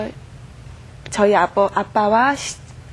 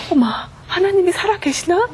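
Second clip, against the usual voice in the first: higher, astonished wondering question; amazed realization; 7 semitones higher than usual. Retold or imitated. Realizing God is alive as parents reconcile, astonished. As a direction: catch your breath in wonder. Her sudden thought about God.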